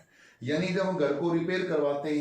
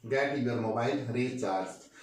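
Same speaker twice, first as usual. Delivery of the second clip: slow, slightly lower repeat of the sentence; 4 semitones lower, much slower than usual. Shown as a demonstration. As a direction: repeat it slowly for the class. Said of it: "क्या बोलेंगे?".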